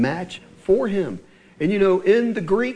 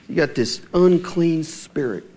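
usual speaking voice

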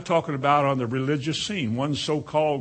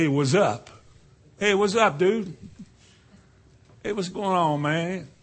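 higher-pitched imitation of hip greetings; mock slang greeting; 5 semitones higher than usual. Preacher acting out worldly slang greetings, higher mock-hip voice. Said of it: "Like I say".

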